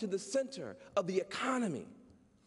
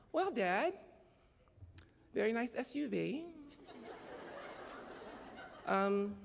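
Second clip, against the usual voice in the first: sly sweet friend; 5 semitones higher, 4 dB quieter than usual. Retold or imitated. Imitating the friend's sly question.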